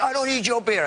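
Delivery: a hint of a belligerent drunk at a charity event; mock drunk bully. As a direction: toss it back like a surly drunk. Drunk guy refusing offer.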